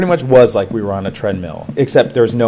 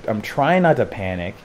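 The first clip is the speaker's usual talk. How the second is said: ordinary delivery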